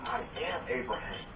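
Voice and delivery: hushed personal affirmation; quiet, meaningful. Shown as a demonstration. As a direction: say it inwardly with meaning. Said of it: "And say it with meaning".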